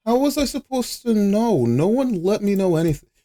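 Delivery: high-pitched aggrieved whine; indignant protest. Real, not acted. Streamer to chat that never told them, indignant. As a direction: plead your case way up high.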